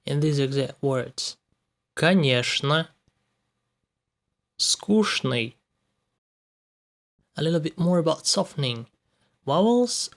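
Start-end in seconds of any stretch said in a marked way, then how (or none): 2.0-2.9 Конечно with чн said as шн; Конечно as конешно. Shown as a demonstration. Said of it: "Ч Н, pronounced like шн". 4.6-5.5 Скучный with чн said as шн; Скучный as скушный. Shown as a demonstration. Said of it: "Ч Н, pronounced like шн".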